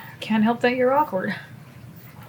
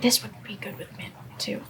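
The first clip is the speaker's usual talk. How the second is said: whisper; 12 dB quieter than usual. Real, not acted. Aside while drinking.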